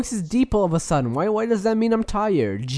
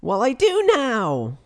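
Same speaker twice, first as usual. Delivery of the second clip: pitched up, bright host voice; higher voice; 3 semitones higher than usual. Acted. Host restarts the show in a higher voice for his co-host. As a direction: lift the voice up for the show. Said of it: "I'm gonna talk in a, in a higher voice".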